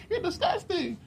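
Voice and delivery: very high squeal with a laugh; disgusted TV girl. Retold or imitated. Imitating girls on TV shows, high mock-disgusted squeal. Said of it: "these girls be like".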